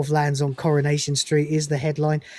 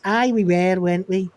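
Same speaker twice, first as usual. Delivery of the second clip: put-on Mancunian accent; 3 semitones higher than usual. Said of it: "they do Mancunian accents".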